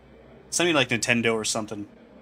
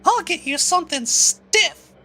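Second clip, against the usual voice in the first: put-on much higher girl voice, bubbly bartender; 4 dB louder, much slower than usual. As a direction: pitch way up into a girl's voice. Bartender character speaks again.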